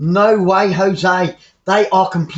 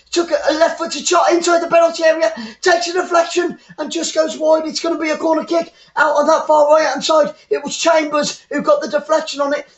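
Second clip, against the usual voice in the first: live play-by-play; 7 semitones higher than usual. Real, not acted.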